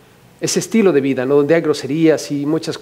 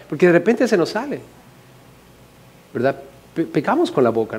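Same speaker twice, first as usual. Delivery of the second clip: ordinary delivery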